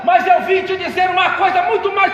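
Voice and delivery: loud, ringing pulpit testimony with music; preaching at full force. Acted. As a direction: preach it out to the whole church. (pregação inflamada em voz alta)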